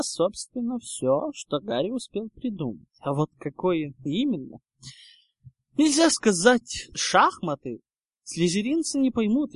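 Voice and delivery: ordinary delivery, nothing marked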